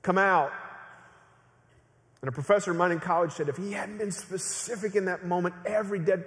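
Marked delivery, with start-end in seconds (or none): 0.0-0.5 raised, louder call into a tomb; commanding shout. Acted. Jesus calls dead Lazarus from the tomb, shouting. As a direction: call out in command, with a pause between. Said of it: "He shouts it".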